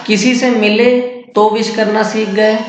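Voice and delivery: normal talking voice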